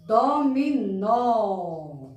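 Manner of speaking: "Dominó" very slow, syllable by syllable, nó held; stressed last syllable. Shown as a demonstration. Teacher repeats for children, drawing out "nó" in "Dominó". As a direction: stretch every beat of "Dominó", longest on "nó". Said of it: "som mais forte é no nó".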